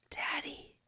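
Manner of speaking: imitating a sleepy little daughter's whisper. Daughter whispering to dad at bedtime, soft whisper. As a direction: breathe it out softly, a child at bedtime. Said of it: "she goes".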